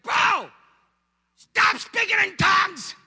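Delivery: high, loud, drawn-out mock yell; exasperated shout. Pastor to husband on phone, exasperated retold shout. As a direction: explode at him, stretching each word.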